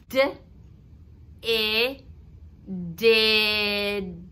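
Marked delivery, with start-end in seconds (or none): 1.4-4.2 each sound "n", "a" said separately, then blended into "nut". Shown as a demonstration. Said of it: "say the blend and then add".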